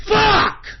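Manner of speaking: real high, loud curse; one explosive, high-pitched yell; enraged shout. Real, not acted. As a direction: scream the curse in fury. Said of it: "I'm so fucking pissed".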